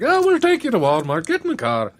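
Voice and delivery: a clumsy fake Irish accent; bad Irish accent. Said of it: "That was a terrible Irish accent".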